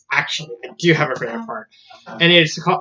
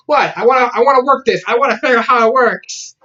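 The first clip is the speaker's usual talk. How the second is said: high, quick acted protest; acted-out eager insistence; insistent, eager; 7 semitones higher, 3 dB louder than usual. Voicing Peridot's insistence in retelling.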